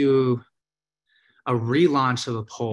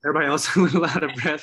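laughing, a bit winded; 3 semitones higher, 4 dB louder, much faster than usual. Real, not acted. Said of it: "I'm a little out of breath".

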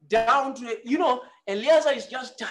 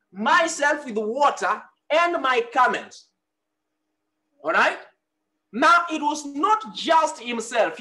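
loud, ringing sermon delivery, calling out to the hall; preaching at full force; 4 dB louder than usual. Acted. Pastor preaching loudly to the congregation about seeking a wife. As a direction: press each point on the congregation like a pastor in full flow.

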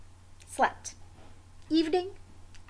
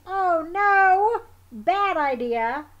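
dismayed oh no; 7 semitones higher, 9 dB louder, slower than usual. Real, not acted. Sees the wombat's bad idea.

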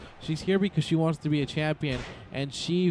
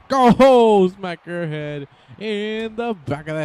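much higher, much louder yell; excited shout; 6 semitones higher, 10 dB louder, much slower than usual. Real, not acted. Commentator urging Naomi on, yelling much louder and higher. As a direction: shout it like a fan on his feet.

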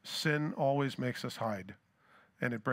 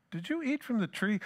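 a higher, incredulous ask, as if it can't be true; disbelieving question; 6 semitones higher than usual. Retold or imitated. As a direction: ask like the thought never crossed your mind. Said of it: "It's like that's the furthest thing from God's mind".

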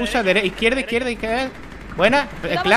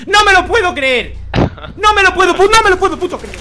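shouting in disbelief, higher; loud disbelief; 11 semitones higher, 6 dB louder than usual. Real, not acted. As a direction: yell it, unable to believe it. Disbelief after game event. (incredulidad a gritos)

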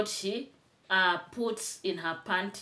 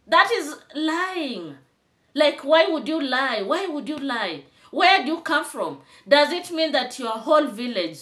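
indignant; 5 semitones higher, 5 dB louder than usual. Real, not acted. Indignant rant at rival.

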